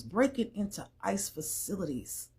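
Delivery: usual speaking voice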